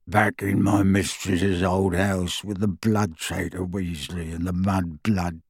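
slow, soft, low croaking grumble; resentful house elf mumbling; muttering elf. A narrator's reading. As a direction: mutter the insults under your breath. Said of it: "muttering to his knees".

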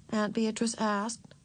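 ordinary delivery

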